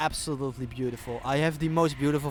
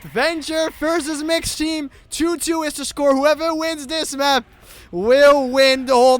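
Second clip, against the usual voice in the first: loud, higher live commentary; excited play-by-play; 8 semitones higher, 10 dB louder than usual. Real, not acted. Caster on the deciding map, loud excited play-by-play. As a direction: call the race with energy.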